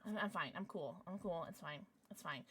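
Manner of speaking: soft, low, repeated; small low voice, short phrases; muted self-reassurance. Real, not acted. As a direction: talk yourself down under your breath. Reassuring self after stopping an outburst.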